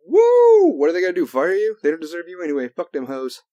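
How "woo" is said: very high, very loud whoop; full-throated Ric Flair woo. Shown as a demonstration. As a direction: let out a wrestler's whoop. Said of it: "Just fucking be like".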